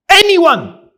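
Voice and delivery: high, loud call to the room; preacher calling on his listeners. Real, not acted. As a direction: call out for an answer. Calling out to the audience.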